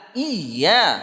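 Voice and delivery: "Iya" stretched to show the single y; dropped letter demo. Shown as a demonstration. Teacher pointing out the lost letter, "Iya" with a single y. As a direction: isolate "Iya" with no doubled y.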